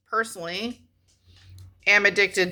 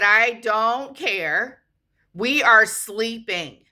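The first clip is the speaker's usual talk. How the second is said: slow annoyed refusal; 4 semitones higher, slower than usual. Retold or imitated. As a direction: lay out each word slowly to get rid of him.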